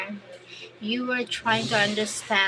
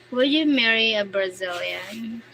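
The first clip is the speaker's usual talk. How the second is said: ordinary talk, nothing marked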